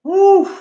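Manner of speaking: high, loud "woo" of relief; sharp high post-workout woo; winded whoop. Real, not acted. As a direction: whoop out a big breath after exertion. Recovering after the workout round.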